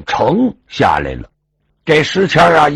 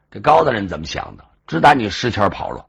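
ordinary delivery, nothing marked

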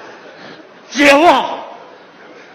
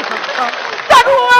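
high, endlessly stretched howl; mourning wail; 9 semitones higher, much slower than usual. Acted. Nephew told to keep mourning his aunt, high wail. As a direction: wail high and drag the final sound out. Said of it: "这都出这声了".

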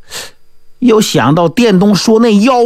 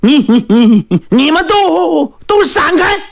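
high, stuttering cry, each word tripping; frightened brother stammering as he charges, voice climbing; stammering in fear; 8 semitones higher than usual. Acted. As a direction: blurt it out, stumbling over every word. Wang Qi stammering, weapon raised.

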